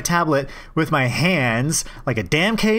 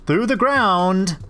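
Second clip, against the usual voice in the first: high defensive voice; 9 semitones higher, slower than usual. Acted. Voicing accused raccoon.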